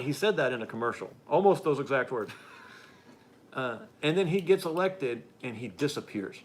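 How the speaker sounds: ordinary talk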